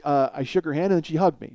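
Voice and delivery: ordinary delivery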